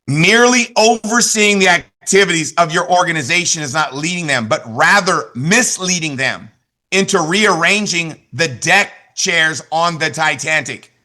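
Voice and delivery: slow, weighty reading, "misleading" and "Titanic" drawn out; slow dramatic quote. Shown as a demonstration. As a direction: deliver it slowly, like a punchline you savour. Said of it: "Listen to this. You'll love this one".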